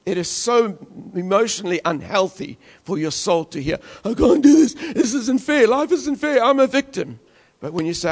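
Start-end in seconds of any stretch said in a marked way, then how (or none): 4.0-7.2 higher-pitched, put-on whiny victim; whiny complaint. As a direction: whine it like a defeated victim. Acting out defeated complaining.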